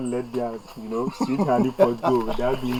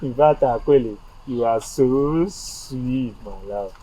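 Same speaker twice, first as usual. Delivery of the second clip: sweet-talking. Acted. Man flattering Akwele as his love, slow sweet-talking. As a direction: draw it out to woo them.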